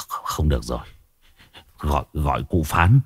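low, faltering alarm; alarmed stammer. Acted. Husband realizing his wife is possessed, hushed alarmed stammer. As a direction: stammer softly, shaken by what you see.

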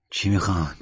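understated muffled question. A narrator's reading. Sheikh asking what the visitors want, muffled voice. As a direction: ask it in a slightly stifled voice. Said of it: "با صدای خفه پرسید".

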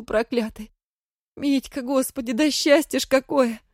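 high, loud, sobbing with relief; cling to him, overjoyed; tearful joy. Acted. Wife overjoyed at husband's return.

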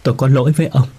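a widow crying out to her husband's spirit, voice rising in distress; sobbing. A narrator's reading. Widow begging her dead husband to hear her, tearful and rising. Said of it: "Bà Hạnh nức nở".

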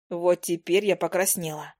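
ordinary delivery, nothing marked

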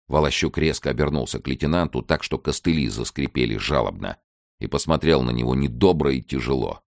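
usual speaking voice

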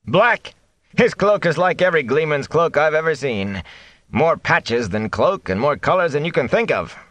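amused mayor. A narrator's reading. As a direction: chuckle away the fear. Bran voiced, chuckling.

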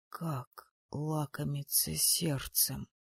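slow, soft horrified whisper. A narrator's reading. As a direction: whisper it in shock. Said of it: "прошептал побледневший Харрор".

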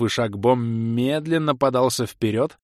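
ordinary delivery, nothing marked

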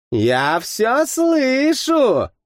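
high, drawn-out singsong tease; teasing sing-song. A narrator's reading. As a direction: stretch it like a playful warning. Arata wags finger, sing-song. (протяжно, дразняще-нараспев)

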